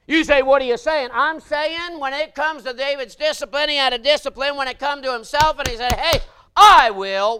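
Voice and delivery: loud, high preacher's holler; full-force preaching. Acted. Preacher warning the congregation about temptation, hollering. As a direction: drive it home to the pews.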